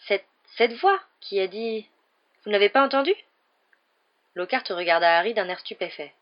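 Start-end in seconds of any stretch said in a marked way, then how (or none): 0.1-3.2 shaken stammer. A narrator's reading.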